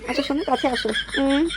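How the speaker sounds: very high, slow coy protest; coy wife. Acted. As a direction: play the wife pushing him off, coyly. Wife in bedroom scene.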